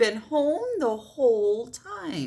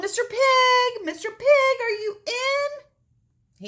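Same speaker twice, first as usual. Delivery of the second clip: high, slow sing-song call; sweet calling out; 13 semitones higher, 5 dB louder, much slower than usual. Acted. Wolf calls the stick-house pig, high, slow and sing-song. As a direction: croon up at the door like a friend.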